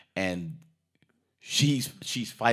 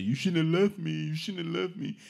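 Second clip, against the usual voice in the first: high put-on pleading voice; mock ex-husband plea; 9 semitones higher than usual. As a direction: plead like a wounded ex.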